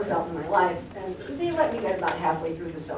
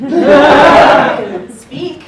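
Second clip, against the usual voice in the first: laughing through the quote; 8 semitones higher, 15 dB louder, slower than usual. Retold or imitated. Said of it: "and she said".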